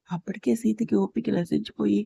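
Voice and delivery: usual speaking voice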